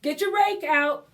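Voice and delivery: ordinary delivery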